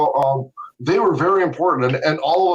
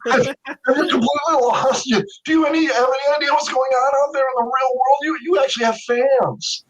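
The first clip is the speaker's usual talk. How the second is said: imitating an exasperated friend, much higher; mock disbelief, pitched up, with a laugh; incredulous friend; 10 semitones higher than usual. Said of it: "And he goes".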